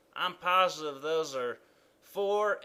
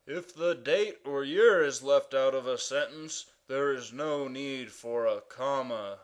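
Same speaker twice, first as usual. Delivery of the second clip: ordinary talk, nothing marked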